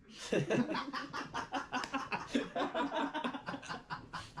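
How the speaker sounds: real high-pitched fit of giggles; can't stop laughing, rising squeal; breathless giggling fit; helpless high giggle. Real, not acted. Interviewer cracks up mid-question.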